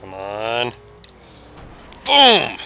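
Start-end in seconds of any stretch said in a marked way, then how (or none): 2.0-2.6 loud, high-pitched BOOM; punchy yelled sound effect; exclaimed boom. Real, not acted. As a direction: shout it like an impact. Smashing into friend's car.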